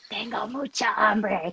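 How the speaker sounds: slow, creepy drawn-out plea. Retold or imitated. Kid sneaking to mom's bed at dawn, slow and creepy. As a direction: creep up on a sleeping parent, slow and eerie. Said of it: "you be creepy and come by my bed".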